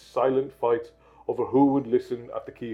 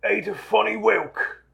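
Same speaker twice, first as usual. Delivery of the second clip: Uncle Vernon; 5 semitones higher than usual. Acted. Uncle informs aunt of the postcard news, lightly acted announcement.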